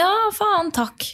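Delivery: high flustered jump on the first word, then a dropped thank you; awkward blurted thanks. Retold or imitated. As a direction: caught off guard by a polite stranger. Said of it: "Og så sier jeg sånn".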